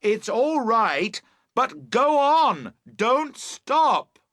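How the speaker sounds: slow, high urgent shout. A narrator's reading. Boatman telling Emily to keep going, shouting. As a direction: shout it slowly across the distance. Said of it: "you shout".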